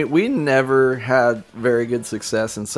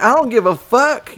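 retold defiant yell; 11 semitones higher, 3 dB louder than usual. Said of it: "I'm like".

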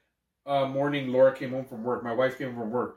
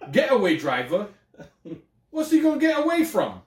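loud, high mock British voice; British accent; 7 semitones higher, 5 dB louder than usual. Riffing with co-host on British bit, high and loud accent. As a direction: exclaim it in an exaggerated British accent. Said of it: "spoke in a British accent".

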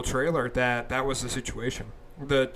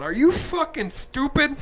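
high, slow, incredulous outburst; exasperated disbelief; 11 semitones higher, slower than usual. Retold or imitated. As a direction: ask it like they're idiots. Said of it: "And I'm looking at 'em going".

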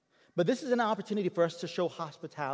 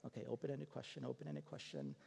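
quiet reminder to himself; 16 dB quieter than usual. Retold or imitated. Young pastor reminding himself under his breath, hushed murmur. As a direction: coach yourself in a hushed murmur.

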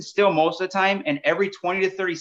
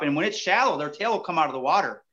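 normal talking voice

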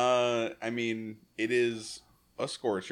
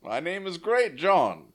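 a lightly put-on English accent, higher; middling English accent; 6 semitones higher, 4 dB louder than usual. Podcast host joking as Great John, in a mild English accent. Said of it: "I have a middling English accent".

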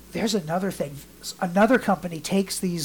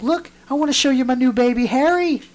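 higher, proud grandmother voice; doting grandmother; 7 semitones higher, 5 dB louder than usual. Retold or imitated. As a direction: show off the doll in a higher, doting voice. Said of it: "And she's like".

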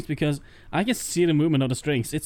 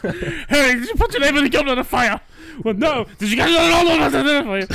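much higher, louder laughing shouts; mock furious interrogation; 10 semitones higher, 6 dB louder than usual. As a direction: corner the boy and yell the question. Movie Dumbledore grilling Harry.

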